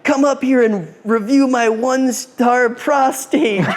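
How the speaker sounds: high, stretched-out heckling call; mock megaphone yell. Drunk heckler taunting the show's host over megaphone, mock yell. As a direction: imitate someone hollering over a megaphone. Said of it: "yelling this shit".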